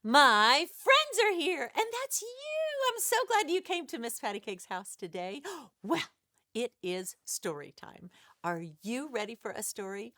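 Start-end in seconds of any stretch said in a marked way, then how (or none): none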